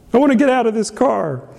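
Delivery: high mock complaint, sliding down at the end; fed-up child. Small child stuck in the car, fed-up imitation. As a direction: imitate a kid who has had enough. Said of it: "some variation of that".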